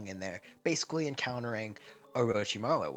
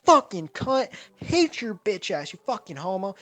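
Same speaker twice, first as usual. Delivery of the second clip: screamed, much higher and louder; furious shout; 10 semitones higher, 10 dB louder than usual. Acted. Naruto stomping on Orochimaru, furious screaming. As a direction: rage at him full force.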